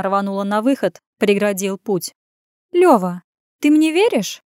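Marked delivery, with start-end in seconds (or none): 2.7-4.4 wheedling woman, high rise at the end; coy purr. A narrator's reading. Woman angling for his trust, purring. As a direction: coax him, lifting the question high. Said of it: "мурлыкнула эта заноза".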